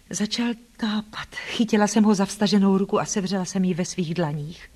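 ordinary delivery, nothing marked

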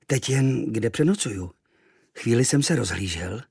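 ordinary delivery

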